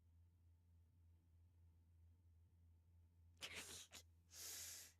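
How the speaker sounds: drawn-out low laugh; long low chuckle. Real, not acted. Streamer laughing at her own question, long and low.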